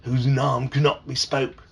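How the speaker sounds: slow British accent with "nom" said like nahm; slow, deliberate British take on the line. Shown as a demonstration. Dad redoing the line on request, British accent. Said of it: "Wait, do it again".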